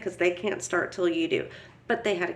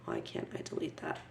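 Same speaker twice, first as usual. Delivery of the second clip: frustrated whisper at the screen; whispered to herself; 15 dB quieter, faster than usual. Real, not acted. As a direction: breathe it under breath. Muttering at the computer.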